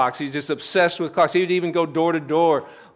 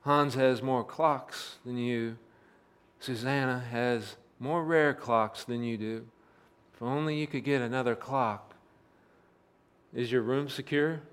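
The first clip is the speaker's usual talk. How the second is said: hushed, much softer tempting murmur; leaning in to whisper; 4 semitones lower, 11 dB quieter than usual. Acted. Thief repeats the whispers to the collector, hushed. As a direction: lean close and breathe the old taunts. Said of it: "he leaned forward and he says".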